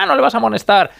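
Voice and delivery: high-pitched retold player complaint; mock protest. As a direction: imitate a player appealing to the referee. Mimics player protesting to referee.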